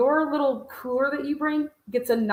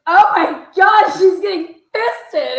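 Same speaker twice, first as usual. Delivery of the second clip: high, loud, slow shocked shriek; retelling Courtney's outburst, high and loud, in mock horror; 7 semitones higher, 10 dB louder, slower than usual. Friend gasping at the scene, shrieking in shock.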